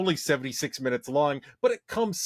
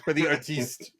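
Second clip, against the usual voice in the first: put-on French accent. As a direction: play a French art snob. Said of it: "I put a French accent".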